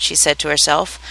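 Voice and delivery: ordinary delivery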